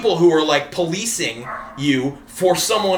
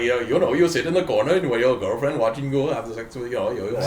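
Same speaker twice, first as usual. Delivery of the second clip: put-on comic Indian accent, lower than usual; 4 semitones lower than usual. Indian guy in the comic's bit comes up to him, put-on Indian accent. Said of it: "you're doing an Indian accent".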